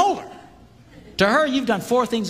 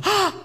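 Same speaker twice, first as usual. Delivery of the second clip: delighted gasp; 13 semitones higher than usual. Retold or imitated. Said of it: "she'll go".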